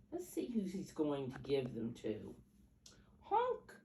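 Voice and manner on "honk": high honk like a goose. Acted. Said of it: "says the goose".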